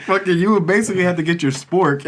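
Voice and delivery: laughing. Real, not acted. Friends recalling greasy cafeteria tacos, laughing. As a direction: laugh through the memory.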